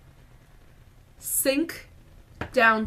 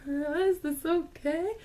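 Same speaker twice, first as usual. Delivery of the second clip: hesitant, drawn-out, higher question; tentative; 7 semitones higher, slower than usual. Retold or imitated. Fan asking Ed as she poses beside him, tentative. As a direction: ask it timidly, feeling your way.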